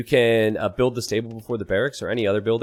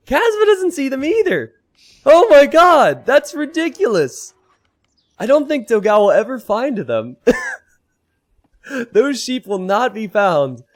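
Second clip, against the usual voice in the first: high, loud disbelieving exclamation; incredulous; 12 semitones higher, 7 dB louder than usual. Real, not acted. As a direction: exclaim through laughter. Sheep still missing, caster exclaims.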